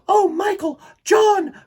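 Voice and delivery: high, loud happy call; Wendy calls her brothers, overjoyed; joyful cry. Acted. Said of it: "cried Wendy".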